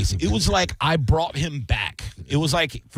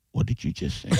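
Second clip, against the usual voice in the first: hushed disbelief; 3 semitones lower, 6 dB quieter than usual. Retold or imitated. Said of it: "He goes".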